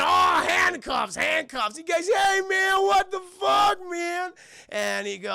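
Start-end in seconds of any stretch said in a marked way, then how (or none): 0.0-1.8 high, loud burst; spring it on them loudly; mock exclamation. Storyteller acting out the sudden handcuffing, high and loud. 2.1-4.3 very high, loud mock protest; outraged yell. Jumper to the cop who cuffed him, high outraged yell.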